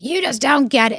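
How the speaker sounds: high-pitched mock protest; retold exasperation. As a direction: act out an exasperated complaint to a partner. Retold exasperated outburst at boyfriend.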